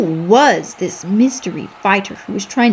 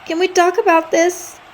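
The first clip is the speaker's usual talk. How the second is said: begging; 9 semitones higher than usual. Acted.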